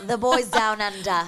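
put-on loud, broad Australian accent; hammed-up Aussie, playing along; badly faked Australian accent; mock Australian accent. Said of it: "accent was right".

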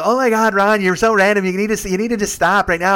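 loud, high mock scolding; exasperated scolding. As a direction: act out friends telling him off. Friends scold Ron in retold scene.

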